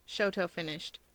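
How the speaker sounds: plain reading voice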